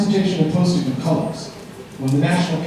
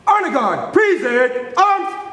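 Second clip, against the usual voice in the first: high sung-out drill command; rising call to present arms; drill command shout; 10 semitones higher, slower than usual. Real, not acted.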